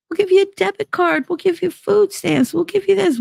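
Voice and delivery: very high, singsong mock generosity; mocking officials. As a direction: parody officials handing out goodies in a high voice. Mocks officials handing out benefits.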